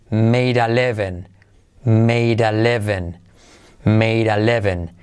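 slow "made a living" with the D flapped and joined to a; slow linked made a. Shown as a demonstration. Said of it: "se convierte en made". ("made a" unido, D como R suave, despacio)

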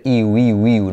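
"iu" gliding from ee into oo; glide Y sound. Shown as a demonstration. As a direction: say "iu" with a vowel-like Y, no CH. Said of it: "no chu".

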